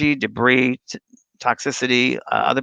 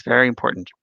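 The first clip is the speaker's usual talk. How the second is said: ordinary talk, nothing marked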